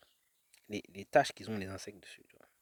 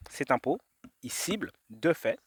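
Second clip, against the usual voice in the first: slowly part "cet impôt", "il cible", "deux faits"; "cet impôt / il cible / deux faits", each piece rising; hidden words separated; 2 semitones higher, slower than usual. Shown as a demonstration. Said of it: "elle impose quelque chose". (« cet impôt, il cible, deux faits » découpé lentement)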